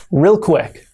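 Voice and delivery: ordinary delivery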